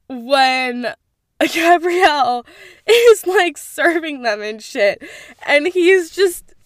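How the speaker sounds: giggling through the words, loud and high; laughing. Real, not acted. Fan recalling a hilarious date scene, laughing. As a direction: laugh as you remember it.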